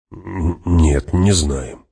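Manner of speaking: slow, stumbling start; hesitant. Acted. Hawat admits he doesn't know, slow and hesitant. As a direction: stall on the first sound, then answer slowly.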